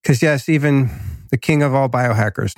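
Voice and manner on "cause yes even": stuffed-up, sick-sounding voice; talking through a head cold; nasal from a cold. Real, not acted. Host admits to listeners he sounds congested, nasal. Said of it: "you might hear I have a little nasally kind of voice".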